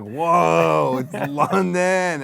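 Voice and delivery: hammed-up amazement, stretched out; mock awe. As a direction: gawp like an amazed tourist. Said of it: "and then we're like".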